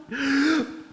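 a sharp hammed-up inhale; mock held-breath gasp. Preacher pretending to hold his breath for God. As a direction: suck in air and hold it. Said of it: "Holding my breath".

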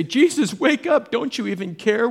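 high, loud frantic cry to a sleeping Jesus; panicked plea. Acted. Disciples in the storm wake Jesus, high loud panic. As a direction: shout him awake as the boat sinks.

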